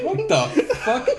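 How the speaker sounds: laughing disbelief. Real, not acted. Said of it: "And I was like".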